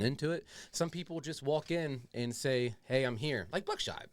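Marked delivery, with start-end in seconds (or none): none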